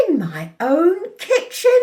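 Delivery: high, loud outraged question; indignant. A narrator's reading. Mother told off in her own kitchen, indignant. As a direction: rise in disbelief and affront.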